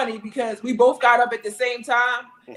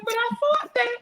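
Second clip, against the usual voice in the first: high-pitched, put-on complaint; mock protest; 11 semitones higher than usual. Wife tells husband what he should say, mock protest. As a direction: insist on the expected script in a higher voice. Mimics protest at unscripted reply.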